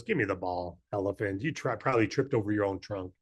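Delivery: low, soft, gruff lion. Acted. Lion scoffing at the elephant, in a low gruff voice. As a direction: growl it down low like a scornful lion. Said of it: "the lion goes".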